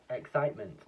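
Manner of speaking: ordinary delivery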